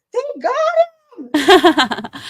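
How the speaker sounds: high, loud, stretched joyful scream. Retold or imitated. Retelling the moment the news dropped, a stretched high scream. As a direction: scream it out in disbelief. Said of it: "I started screaming".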